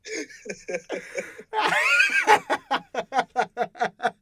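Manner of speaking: loud, high burst of laughter; helpless, high-pitched cracking up; real belly laugh. Real, not acted. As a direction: burst out laughing and keep it going. Reacting hard to the accent bit.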